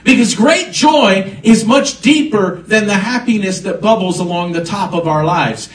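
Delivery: full-force pulpit preaching; impassioned preaching. Acted. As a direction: lift the congregation with rising fervor. Preaching about sensing burdens.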